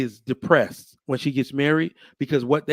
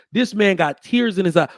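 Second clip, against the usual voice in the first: usual speaking voice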